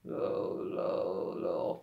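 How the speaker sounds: soft creaky low; fry low. Shown as a demonstration. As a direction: croak low softly. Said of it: "melhor do que fazer assim". (low em vocal fry)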